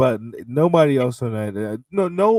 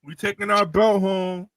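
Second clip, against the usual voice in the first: hyped; 10 semitones higher than usual. Real, not acted. Hyped cheering for Nakamura.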